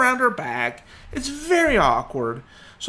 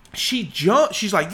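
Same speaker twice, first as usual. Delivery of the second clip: ordinary talk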